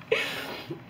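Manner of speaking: high airless wheeze of laughter; wheeze, laughing too hard to voice it; silent wheezing laugh. Real, not acted. Cracking up at herself.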